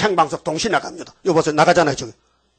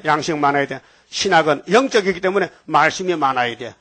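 normal talking voice

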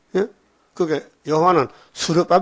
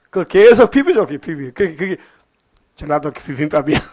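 ordinary delivery

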